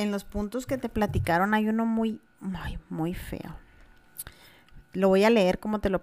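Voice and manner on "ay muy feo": whispered "Ay, muy feo". Real, not acted. Host reacting to an ugly story, whispered and softer. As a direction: breathe it out with no voice, dismayed.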